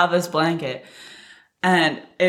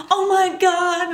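very high, slow mock wail; retold panic; 13 semitones higher, slower than usual. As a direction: imitate a panicked kid about to cry. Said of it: "Like, I'm panicked".

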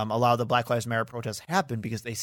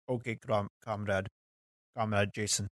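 put-on Russian accent; 3 semitones lower, 5 dB quieter than usual. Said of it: "That's my horrible Russian accent".

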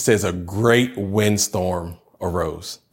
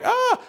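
mock startled kids' yelp. Kids react to parent.

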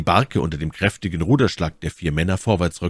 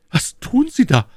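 high, startled lady's voice; taken aback; 5 dB louder than usual. A narrator's reading. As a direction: ask it in dismay, pitched far up. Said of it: "fragte My Lady betroffen". (betroffene, hohe Frauenstimme)

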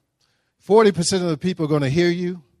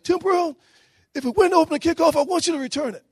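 much higher, hammed-up excited coach voice; 12 semitones higher than usual. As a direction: squeal the order like a coach who can't contain himself. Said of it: "Coach, uh, Faust gets all excited".

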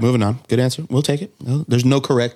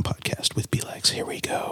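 whispering; 9 dB quieter than usual. Acted. Hushed segment intro.

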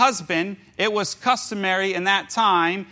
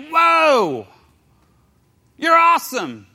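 impressed whoa; 7 semitones higher, 6 dB louder, slower than usual. Retold or imitated. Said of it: "he was like".

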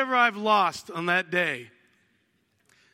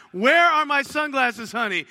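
mock exasperated search; 5 semitones higher, 8 dB louder than usual. Searching house for sunglasses.

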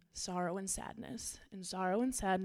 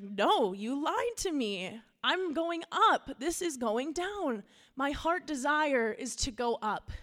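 higher and louder, slow and insistent; pleading protest; 5 semitones higher, 5 dB louder, slower than usual. Acted. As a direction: dig in, refusing to go down. Much Afraid protests to Shepherd.

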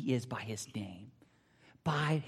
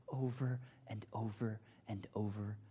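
fading whisper; 6 semitones lower, 12 dB quieter than usual. Real, not acted. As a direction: drop to a whisper, much softer and lower. Trailing off on repeated promises.

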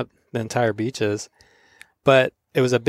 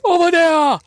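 much higher, louder Hawaiian pidgin call; shouted catchphrase; 5 dB louder than usual. Retold or imitated. Passers-by calling out to him in loud Hawaiian pidgin. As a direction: holler it across the street. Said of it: "people were like".

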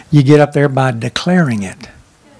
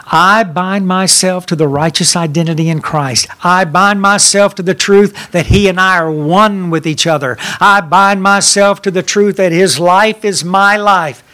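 louder, fervent proclaiming prayer; declaration prayer; 3 semitones higher, 5 dB louder than usual. Acted. Pastor leading the church in a declaration, fervent and loud. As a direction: proclaim each line like a vow.

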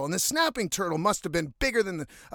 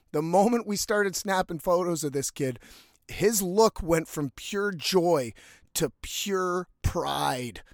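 ordinary delivery

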